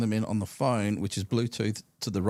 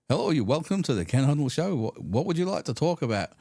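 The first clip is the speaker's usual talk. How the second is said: raised, lively phone-in host greeting; imitated radio host; 5 semitones higher, 3 dB louder than usual. Radio host welcomes a caller to his show, higher lively host voice. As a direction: play an upbeat host taking a call. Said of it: "he'd get callers through and he'd go".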